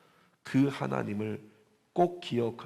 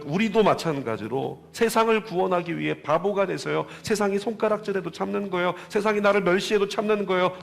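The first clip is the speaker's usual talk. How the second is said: rising, louder and higher toward full-force preaching; building preaching; 7 semitones higher, 5 dB louder than usual. Acted. Preacher exhorting the congregation, building to a shout. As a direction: build the call up to a climax.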